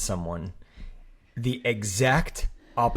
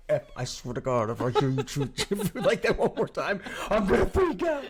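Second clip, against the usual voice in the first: mock exasperated; 4 semitones higher than usual. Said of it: "you're like".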